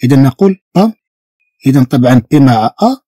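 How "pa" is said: "با" with a hard P; hard French P. Shown as a demonstration. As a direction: say "با" as "pa", with a firm, doubled-strength P. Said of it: "بي مشددة".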